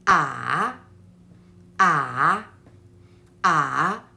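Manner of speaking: "Ah" dipping low then rising back up, low voice; third tone demo. Shown as a demonstration. Teacher showing learners the dipping third tone "Ah". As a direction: let each "Ah" fall to the bottom and turn up. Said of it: "There is a turn in the third tone".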